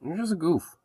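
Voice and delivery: high sing-song voice for the dog; pet talk. Real, not acted. Owner cooing to her big dog, high and playful.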